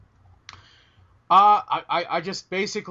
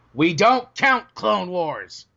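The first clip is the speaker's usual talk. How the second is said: mock stern; much slower than usual. Mock insistent correction.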